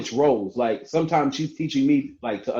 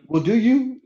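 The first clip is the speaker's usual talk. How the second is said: high, louder skeptical comeback; pointed question in a raised, put-on pitch; challenging retort; 11 semitones higher, 5 dB louder than usual. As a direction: act out a spouse calling the bluff. Acted challenge in couple's spat.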